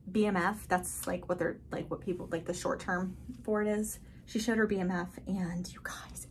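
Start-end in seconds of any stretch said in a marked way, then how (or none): 4.2-6.3 whispering. Real, not acted. Secret-sharing whisper.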